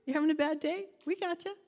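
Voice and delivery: high, bright reassuring voice; comforting friend. Retold or imitated. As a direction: cheer up a friend having a bad day. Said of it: "children that say".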